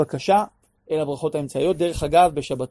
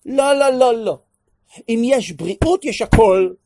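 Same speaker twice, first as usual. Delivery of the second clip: loud, higher mock cashier; imitating the cashier; 7 semitones higher, 10 dB louder, slower than usual. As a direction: insist like the cashier did. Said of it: "מה היא אמרה לי". (חיקוי של הקופאית, בקול רם וגבוה)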